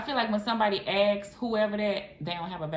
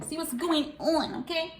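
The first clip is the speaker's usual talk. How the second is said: singsong echo; 9 semitones higher than usual. Real, not acted. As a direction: lift it up high and stretch it.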